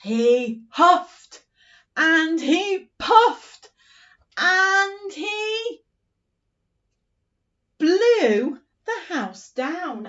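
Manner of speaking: slow, loud, rising chant; storyteller chanting the wolf blowing the house down; sing-song wolf huffing. Shown as a demonstration. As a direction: belt out the climax with huffs. Acting out the wolf.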